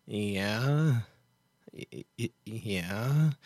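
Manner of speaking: lightly imitated low, soft "yeah"; drawn-out, quiet, halting mock reply; Doctor Phil. Host mimics the TV doctor answering his guest, low halting mimicry.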